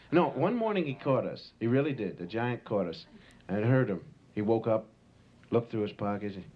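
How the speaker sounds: ordinary talk, nothing marked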